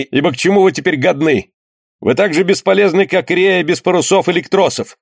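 high-pitched, harried protest; overwhelmed surgeon losing patience; exasperated scolding. A narrator's reading. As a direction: tell off the clamouring crew. Harried surgeon scolds clamouring sailors.

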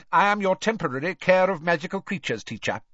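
brisk stern witch, pitched high. A narrator's reading. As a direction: clip through it briskly. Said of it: "she said briskly".

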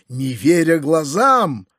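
plain reading voice

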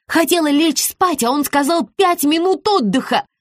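high-pitched, wailing grievance; exasperated complaint. A narrator's reading. Exhausted client complains about the belt to Rita, exasperated. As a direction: whine about being denied sleep.

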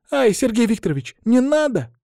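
put-on high-pitched girlish pleading; alarmed plea. A narrator's reading. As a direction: beg him to stop, voice way up. Girl begs teacher to stop. (испуганная мольба высоким голосом)

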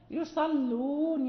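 يصلون drawn out slowly with a light, thin lam. Shown as a demonstration. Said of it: "هنا اللام ما مرققة".

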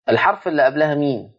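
normal talking voice

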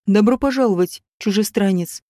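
usual speaking voice

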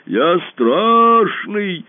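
very slow, loud booming boast; boasting monster. A narrator's reading. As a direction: boom out your might. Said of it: "раздался голос чудовища".

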